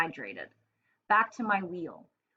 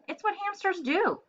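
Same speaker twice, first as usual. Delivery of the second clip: a high, earnest little hamster; lightly raised, matter-of-fact; 11 semitones higher than usual. A narrator's reading. Hamster answering the cat, lightly higher. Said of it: "I said".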